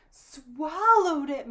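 high, loud, drawn-out exclamation; alarmed cry. A narrator's reading. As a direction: cry it out slowly in horrified disbelief. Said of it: "Maria cried".

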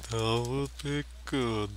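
slow, drowsy Borat accent, mock; half-hearted sleepy Borat voice; sleepy Borat impression. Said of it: "sleepy Borat impression".

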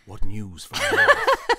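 mock medieval herald; mock epic line. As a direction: proclaim it like a fantasy king. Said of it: "The north always just sounds like".

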